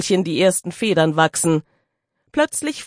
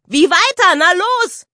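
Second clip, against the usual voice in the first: put-on high, loud provoking child; cheeky girl goading her brother; teasing girl; 11 semitones higher, 4 dB louder than usual. A narrator's reading. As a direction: dare him to answer. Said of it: "neckte ihn das freche Gür".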